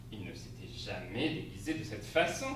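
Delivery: ordinary delivery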